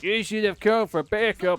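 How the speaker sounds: echoing the thug's line as a goon. Retold or imitated. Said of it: "the go-to goon voice in my head".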